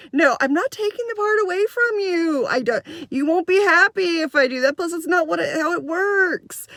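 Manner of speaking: high-pitched, pleading exasperation, last words stretched; exasperated insistence. Retold or imitated. Teacher refusing to take away a girl's part, exasperated. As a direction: protest as a teacher at wit's end.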